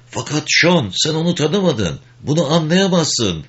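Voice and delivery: high-pitched, mild protest; pleading disbelief. Acted. Garrick protesting his brother's death, pleading in disbelief.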